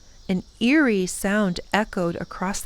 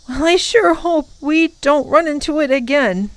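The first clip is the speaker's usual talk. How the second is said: put-on boy voice; 7 semitones higher, 4 dB louder than usual. A narrator's reading. Tom says, worried.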